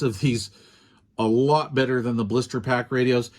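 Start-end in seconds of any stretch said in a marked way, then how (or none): none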